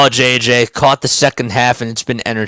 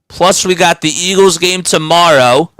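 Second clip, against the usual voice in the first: ordinary talk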